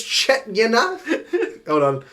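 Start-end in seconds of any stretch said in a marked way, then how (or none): 0.0-1.0 higher, louder patois "Chet, you know" with a laugh; mock patois tag. Host quotes the clip's sign-off in patois.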